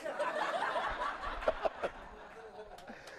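long airy giggle; high breathy laugh. Real, not acted. After the punchline.

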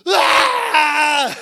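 loud high scream of terror; terrified scream. Retold or imitated. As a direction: shriek at the demon. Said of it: "I remember lying there going".

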